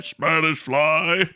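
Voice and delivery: Spanish fly dropped low and slow; low drawl. Retold or imitated. Streamer mimicking the old Cosby record, low sly drawl. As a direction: let it sink like a sly aside.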